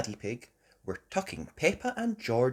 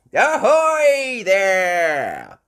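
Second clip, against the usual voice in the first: loud, slow, stretched-out sailor's hail; Grandpa Pig hailing; 8 semitones higher, 13 dB louder, much slower than usual. A narrator's reading. Grandpa Pig arriving on his boat, loud slow ahoy. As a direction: call across the water like an old seadog. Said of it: "Grandpa Pig said".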